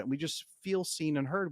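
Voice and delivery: usual speaking voice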